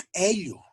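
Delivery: "ello" with popped back k and open e; open vowel after ejective Q. Shown as a demonstration. Teacher illustrating Aymara allophones, opened vowel. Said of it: "se pronuncian abiertas".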